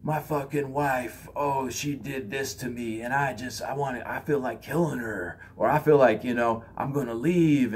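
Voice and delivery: a hint of a fed-up husband venting; upset friend. Friend venting about his wife, lightly voiced grumble. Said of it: "they're like".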